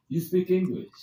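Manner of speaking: a lightly done foreign speaker of broken English, asking. Retold or imitated. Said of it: "But in a very broken English".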